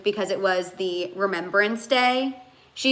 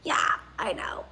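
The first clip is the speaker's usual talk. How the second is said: breathy whispered agreement; 5 semitones lower, 7 dB quieter than usual. Retold or imitated. Answering her friend Holly's teasing, whispered. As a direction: whisper it back like a shy confession.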